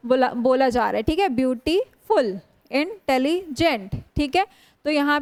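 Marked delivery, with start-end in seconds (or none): 1.3-2.4 beautiful with a stop before फुल; broken into syllables. Shown as a demonstration. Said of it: "वो तीन बार में". 2.7-4.0 intelligent slowly in three chunks, इन - tele - gent; short stops after इन and tele in intelligent; syllable groups. Shown as a demonstration. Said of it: "वो तीन बार में".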